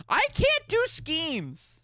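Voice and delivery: high, drawn-out wail; high-pitched protest. Real, not acted. Streamer refusing a pyramid scheme, high drawn-out protest. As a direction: wail it slowly, voice way up.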